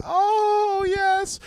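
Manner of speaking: very high, drawn-out ecstatic cry; mock rapture. Camper in a worship moment, mock rapture. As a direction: soar up in hammed-up bliss.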